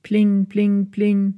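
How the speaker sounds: high ringing pling; doorbell. Acted. Doorbell rings at the flat, high and ringing. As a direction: ring it like a bright doorbell.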